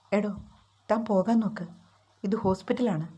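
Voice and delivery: usual speaking voice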